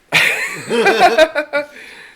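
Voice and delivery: loud high cackle; big burst of laughter. Real, not acted. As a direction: laugh out hard. Laughing at his own excuse.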